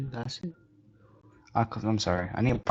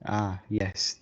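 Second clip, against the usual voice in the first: ordinary talk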